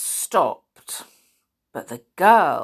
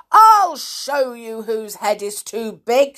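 angry snarl, pushed louder; 4 semitones higher, 7 dB louder than usual. A narrator's reading. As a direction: snarl it at him. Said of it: "she snarled".

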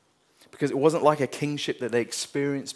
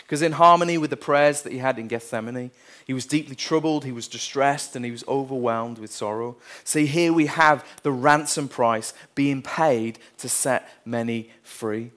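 louder, building sermon climax; preaching at full force; 8 dB louder than usual. Acted. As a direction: drive the point home from the pulpit.

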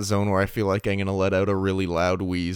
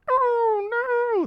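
high mock wail.

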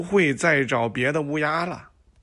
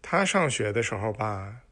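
ordinary delivery

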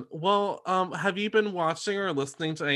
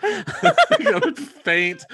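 laughing; 6 dB louder, much slower than usual. Pretend. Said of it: "I am Bridget Jones".

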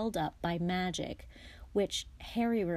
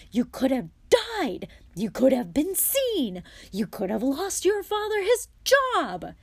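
high, loud scolding, climbing higher; angry shouting; 7 semitones higher, 9 dB louder than usual. A narrator's reading. Mother berates sons for the danger, shouting. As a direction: shout each accusation, building to a peak. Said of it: "Missus Weasley had shouted herself hoarse".

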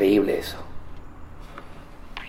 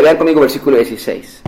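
ordinary talk, nothing marked

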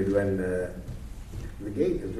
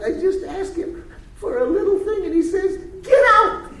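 upset wife; 10 dB louder than usual. Retold or imitated. Woman voiced in retold story.

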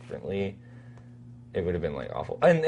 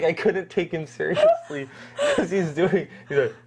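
laughing; 6 semitones higher than usual. Real, not acted. Laughing at actor's lip face.

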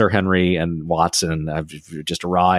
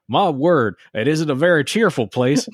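high mock Texan accent, half-laughing; 7 semitones higher than usual. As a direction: play Sir Henry as a hammy Texan, pitched up. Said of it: "wherever the fuck that accent was from".

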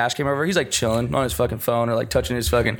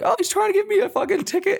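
much higher, whiny mock mom. Son imitates his mom griping to his stepdad, high whiny mock voice. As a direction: squeak it out like a panicking mom. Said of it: "That is pretend woman".

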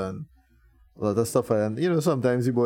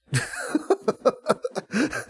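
high, breathy burst of laughter; real laugh; 11 semitones higher than usual. Real, not acted. Band member laughing at his own admission of arguments, high laughter. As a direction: crack up with a high, airy laugh. Joke about arguments at home.

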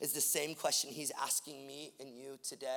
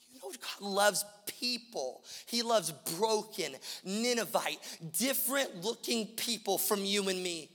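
fervent preaching; 7 semitones higher, 9 dB louder than usual. Real, not acted. Preacher urging the church toward a city in need, fervent preaching. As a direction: stretch the key words and build the appeal.

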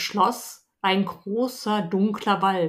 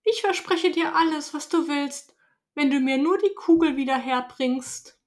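hasty princess promise; 6 semitones higher than usual. Acted. Princess hastily promising the frog everything, higher voice. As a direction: promise anything just to get the ball.

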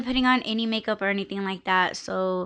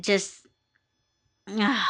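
ordinary talk, nothing marked

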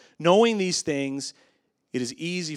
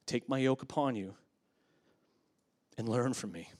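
soft, low, with long pauses; gentle scripture reading; 4 semitones lower, 6 dB quieter than usual. Acted. Preacher reading Jesus' words to the church, gentle and hushed. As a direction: let each phrase settle quietly.

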